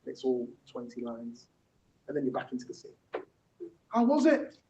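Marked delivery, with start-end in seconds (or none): 3.9-4.6 high-pitched dramatic question; pitched-up stage dialogue; acted questioner. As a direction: ask it as a character in a scene. Acted question from a play scene.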